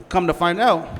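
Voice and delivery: ordinary talk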